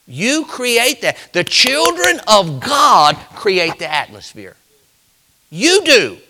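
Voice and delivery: slow, high, loud preacher's cry; preaching at full force. Acted. Pastor preaching hard to his congregation, slow and loud. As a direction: drive it home to the church.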